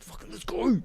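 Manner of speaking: high, hurried whisper; urgent whisper. Retold or imitated. Urging Xavier to leave the stranger, high urgent whisper. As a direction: hiss it to get the kid moving.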